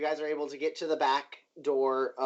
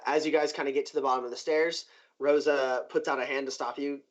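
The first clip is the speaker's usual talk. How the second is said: usual speaking voice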